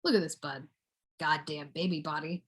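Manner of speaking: low, hushed marvelling; quiet aside. Pretend. Admiring the bud to oneself, quiet aside. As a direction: say it softly under your breath. Aside about the one addressed.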